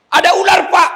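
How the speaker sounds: high, loud panicked shout; panicked yell. Retold or imitated. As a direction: scream for help, a snake is in the cell. Prisoner screams about the snake.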